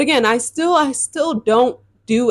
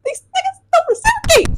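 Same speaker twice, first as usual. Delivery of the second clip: exasperated squeal; faster than usual. Real, not acted. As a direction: pitch jumps way up in disbelief. Exasperated aside about men.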